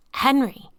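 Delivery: high loud cry of his name; scream. A narrator's reading. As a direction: yell to make him listen. Thea screams at Henry.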